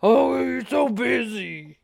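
loud put-on man voice; Mister Biggs. Caller says he's busy, loud mock-manly retort. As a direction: needle him as the mock man. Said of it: "This is Mister Biggs".

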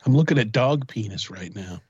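usual speaking voice

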